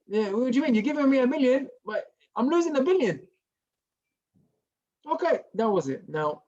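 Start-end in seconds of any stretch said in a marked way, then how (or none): 0.1-3.2 retold exasperated cry, pitched higher; voicing the man's disbelief at his loss; incredulous lament.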